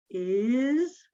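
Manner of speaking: ordinary talk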